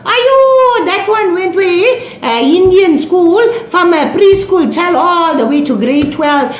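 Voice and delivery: high, exclaiming mock outrage; scandalised auntie. Auntie exposing the woman's Indian schooling, scandalised.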